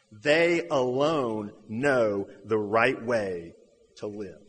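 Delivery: very slow, weighty delivery of a quote; deliberate quotation. Retold or imitated. Preacher quotes an outside observer of the early church, slow and deliberate.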